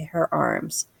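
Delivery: usual speaking voice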